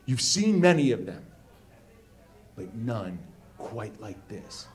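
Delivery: solemn showman unveiling a secret; serious director. Retold or imitated. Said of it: "the director gets very serious".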